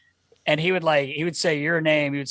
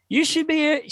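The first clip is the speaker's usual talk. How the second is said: much higher-pitched gushing quote; imitating impressed woman; 12 semitones higher than usual. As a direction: mimic an amazed female listener. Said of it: "She's like".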